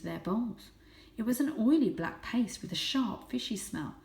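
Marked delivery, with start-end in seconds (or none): none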